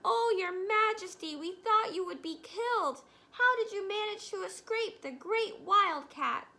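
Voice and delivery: excited exclaiming. A narrator's reading. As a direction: rush in, overjoyed and worried. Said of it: "they exclaimed".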